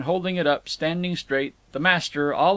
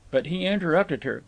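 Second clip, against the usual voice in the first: usual speaking voice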